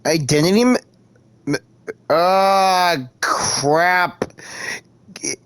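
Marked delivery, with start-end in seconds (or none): none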